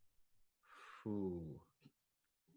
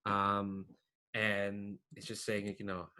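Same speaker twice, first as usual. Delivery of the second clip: usual speaking voice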